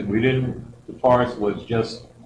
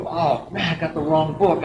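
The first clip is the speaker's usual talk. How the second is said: annoyed; 5 semitones higher than usual. Retold or imitated. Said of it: "I'm got annoyed".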